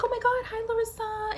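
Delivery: very high, gushing mock greeting; drunk girl. Drunk classmate bumps into her at the party, mock gushing. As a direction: imitate an over-excited drunk girl squealing hello. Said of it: "drunk off her ass".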